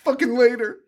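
high mock Lori voice; hammed-up storming-off Lori; toned-down screaming Lori. Lori storms out on her friend, high mock voice. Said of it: "I'm doing it at, like, a toned down version".

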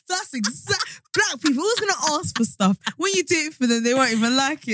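high, indignant online commenter; mock indignant commenter. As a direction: squeak it like an offended viewer. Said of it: "They're definitely like".

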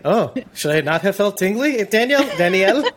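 high, pleading mock voice calling Danielle; mock sheepish question. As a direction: play it embarrassed, appealing to her.

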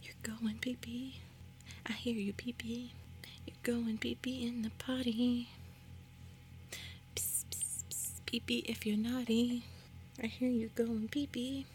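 teasing hushed whisper. Pretend. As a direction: whisper it like a prank.

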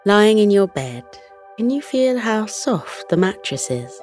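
ordinary delivery

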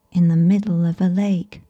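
usual speaking voice

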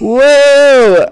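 loud, high-pitched cheer; excited whoop. Real, not acted. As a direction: whoop loudly for your celebrity guest. Host hypes his celebrity guest.

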